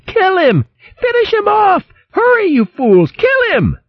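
very high, shrieky hysterical voice; hysterical screaming. A narrator's reading. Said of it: "screaming hysterically".

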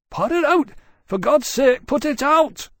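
high, loud, gasping plea; frantic begging. A narrator's reading. Villager begging the dragons to put out the fire, panicked. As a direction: beg in panic as the roof burns. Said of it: "one of them begged, gasping".

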